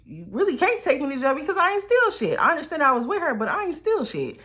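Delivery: retelling a heated denial to police; indignant insistence. Retold or imitated. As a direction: insist hard you stole nothing. Insisting to police she wasn't stealing.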